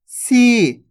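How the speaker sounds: "सी" with a long, held ee; drawn-out vowel. Shown as a demonstration. Teacher showing the long vowel on सा, drawn-out "सी". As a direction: stretch the ee of "सी". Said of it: "खींच खींच के उच्चारण करना है".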